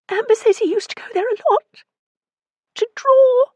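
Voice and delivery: high, wailing and tearful; moaning. A narrator's reading. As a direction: friend moans down the phone. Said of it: "Kirsten moaned".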